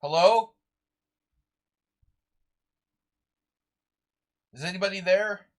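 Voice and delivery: loud, high questioning call. Real, not acted. Streamer to silent chat, checking for anyone, calling out.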